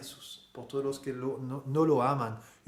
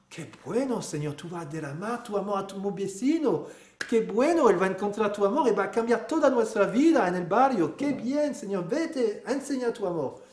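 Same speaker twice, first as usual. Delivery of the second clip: higher and louder, overjoyed; delighted outburst; 6 semitones higher, 5 dB louder than usual. Pretend. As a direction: cheer the Lord on with glee. Creyente entusiasta responde a Jesús. (exclamación alegre y entusiasta, más aguda y fuerte)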